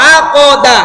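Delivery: teacher calling out the answer loudly; loud drilling. Shown as a demonstration. Teacher drilling students loudly, calling out the syllables. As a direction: belt it out for the class to repeat. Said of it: "এটা কি হবে?".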